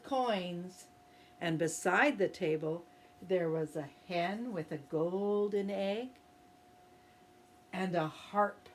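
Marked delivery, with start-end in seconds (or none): none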